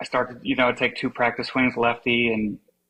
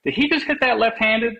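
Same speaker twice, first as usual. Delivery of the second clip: high-pitched mock call from afar; imitated yell; 11 semitones higher than usual. Golfer on the other fairway calling over, imitated high yell. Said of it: "the one guy yells over".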